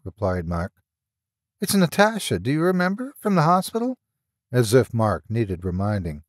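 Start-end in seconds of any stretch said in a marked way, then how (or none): 1.6-4.0 higher, lightly acted woman's voice; female caller. A narrator's reading. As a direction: introduce yourself in a high, questioning lilt. Natasha introduces herself by phone.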